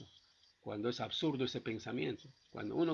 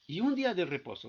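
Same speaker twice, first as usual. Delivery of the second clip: raised, louder Bible reading voice; scripture reading; 6 semitones higher, 4 dB louder than usual. Acted. Preacher reading Hebrews to the church, raised and louder. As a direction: read the verse out to the congregation, lifted and loud.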